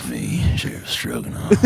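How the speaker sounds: low, soft cowboy voice; sexy cowboy voice. Acted. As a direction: lightly play a sultry sheriff. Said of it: "in a sexy cowboy voice".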